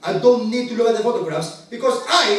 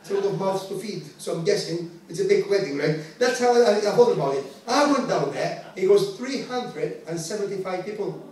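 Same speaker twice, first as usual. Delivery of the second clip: ordinary talk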